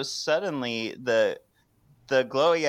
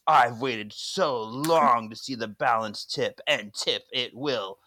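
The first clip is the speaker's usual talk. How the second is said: slow, ominous villain voice; menacing villain; slower than usual. Retold or imitated. Demon threatening the sisters, slow and ominous. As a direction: draw out each threat slowly. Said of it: "And he says".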